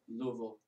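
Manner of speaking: Louisville squashed to LOO-vul; local Louisville, Luh-vul. Shown as a demonstration. Offering co-hosts a third option, Louisville as LOO-vul. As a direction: swallow Louisville into two syllables, LOO-vul. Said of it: "How they say it down there".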